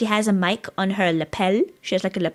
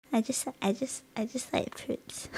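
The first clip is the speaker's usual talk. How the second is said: meek little confession, much softer; sheepish; 3 semitones higher, 10 dB quieter than usual. Pretend. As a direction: plead it in a small, hushed voice. Sheepish defence of liking fruit.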